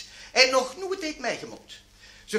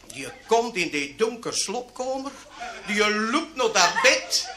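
ordinary delivery

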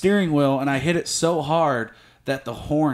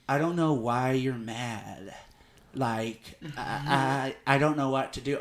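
lower, much softer, slow; mock clueless girlfriend; 6 semitones lower, 9 dB quieter, slower than usual. Boyfriend imitating his girlfriend's clueless excuse, soft and slow. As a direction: murmur it like she doesn't get it. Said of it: "she's still like".

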